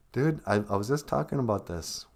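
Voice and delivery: usual speaking voice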